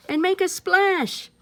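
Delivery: high, loud otter voice. Acted. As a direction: splash it out playfully.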